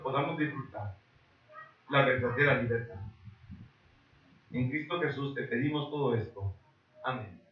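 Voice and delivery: low, softened prayer voice; public prayer. Real, not acted. Pastor praying to God for the congregation, prayer.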